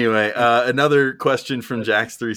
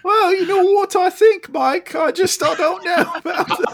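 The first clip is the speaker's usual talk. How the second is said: very high, loud British cartoon voice; Morty; 5 dB louder than usual. Doing British Mad Dog as Morty on request, squeaky impression. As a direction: squeal it out in a bad British accent. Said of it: "Mad Dog as Morty".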